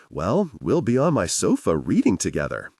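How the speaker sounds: usual speaking voice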